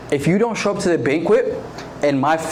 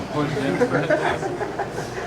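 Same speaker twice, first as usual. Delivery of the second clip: quick high giggle at the horse jam joke; high laugh; 10 semitones higher, 5 dB quieter than usual. Real, not acted. Preacher laughing at own joke to the youth, giggling. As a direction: break into a laugh.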